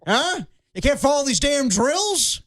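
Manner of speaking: high mock coach rant, fading to a slow whisper; half-paralyzed coach scolding, pitched high; coach yelling. Coach scolds a player who can't follow drills, high mock rant. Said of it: "He was like".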